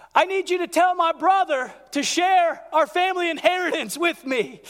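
loud, high call from the back of the crowd; yelling from the crowd. Retold or imitated. Man in the crowd yelling to Jesus, loud and high. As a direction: shout it up to the teacher. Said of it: "and he yells out".

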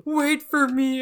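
high, loud cry to someone leaving; desperate shout. Pretend. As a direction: call out high and loud in a hurry. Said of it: "Just someone being like".

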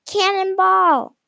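cannonball called out high and loud; hammed-up alarm on cannonball; storybook exclamation; excited cry. Comic punchline exclaimed.

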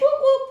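high wailing siren imitation; bullhorn with siren. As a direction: wail like a police siren.

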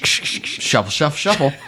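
imitated bag rustling, shushing then words; mock sound effect. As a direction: make rummaging noises with your mouth. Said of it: "Thank you for that sound effect".

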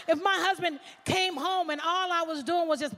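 ordinary talk, nothing marked

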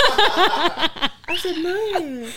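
high, drawn-out laughing wail; laughing confession. Real, not acted. Admitting to friends she refused the jacket, laughing. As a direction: confess it helplessly through laughter.

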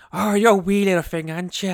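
badly faked Irish brogue, loud at the start; mock a TV actor's terrible Irish accent; David Boreanaz's Irish accent. Host mocks the flashback turning scene, bad Irish accent. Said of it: "nothing can be as worse than David, uh, Boreanaz's Irish accent".

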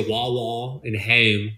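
slow Philly demo, "waw-waw" and "hehm"; Philly accent. Shown as a demonstration. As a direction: draw out the Philly vowels in "wah wah and home". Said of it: "Those are fucking Philly".

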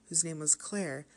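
usual speaking voice

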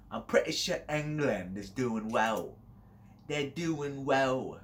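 put-on slow, stretched-out British accent; mock British accent. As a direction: draw the words out in put-on British.

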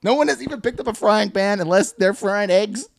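loud, high, exasperated rant; mock-outraged and emphatic; indignant denial. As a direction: protest the absurdity with full comic outrage. Acted indignant rant at coworker.

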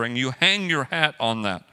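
normal talking voice

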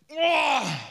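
loud, high, drawn-out groan of outrage; indignant outburst. Acted. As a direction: let out a big appalled roar. Outburst at the pious people's reaction. (empörter, lauter Aufschrei)

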